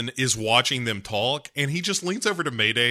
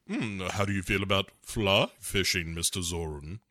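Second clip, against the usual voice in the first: low mock Bond; 8 semitones lower, 8 dB quieter than usual. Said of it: "Bond finally is like".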